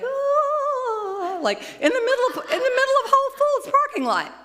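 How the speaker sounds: high singing wail breaking into laughter; laughing cry of release. Real, not acted. Speaker reliving her moment in the parking lot, high laughing wail. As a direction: let it burst out high, half-sung, half-laughing. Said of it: "I was like".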